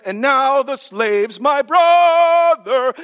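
slow, loud preacher's cry; ringing slowed proclamation; emphatic shout. Real, not acted. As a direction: proclaim it with full force.

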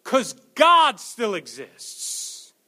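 slow and loud, every word landed; slow, weighty pulpit proclamation; slow emphatic declaration. Acted. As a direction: proclaim it slowly. Sermon climax.

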